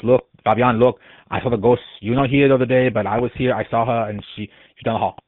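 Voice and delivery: imitating a resident's heavy Spanish accent; strange Spanish accent. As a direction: insist eagerly in a mimicked Spanish accent. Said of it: "he's a very strange accent".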